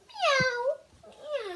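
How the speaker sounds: long high meow that falls away; cat meow. Acted. Cat meows at the trapped mouse, high and drawn out. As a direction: meow like a toy cat pouncing.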